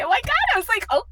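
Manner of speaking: very high, thrilled gasping voice; overwhelmed squeal. Real, not acted. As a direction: squeak with delighted shock. Reliving excitement at a donation.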